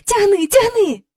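high, urgent call; urgent cry. Acted. Jane urges Michael at the string, high urgent cry. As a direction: urge him to pull, high and pressing. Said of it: "скрикнула вона".

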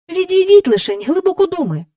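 high-pitched, cross nanny's order. A narrator's reading. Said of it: "сердито сказала Мері Поппінс".

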